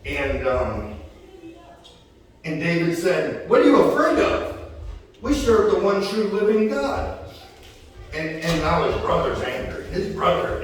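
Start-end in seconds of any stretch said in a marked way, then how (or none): 3.5-7.2 higher-pitched, incredulous retort; incredulous challenge. Retold or imitated. Preacher as David challenging frightened soldiers, mock incredulous.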